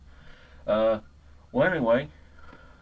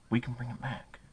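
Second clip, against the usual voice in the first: hushed appeal; 8 dB quieter than usual. Real, not acted. Earnest rallying appeal, voice dropping.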